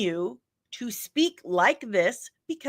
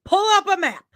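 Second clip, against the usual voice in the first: exasperated command; 9 semitones higher, 5 dB louder, faster than usual. Retold or imitated. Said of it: "I kept telling this person".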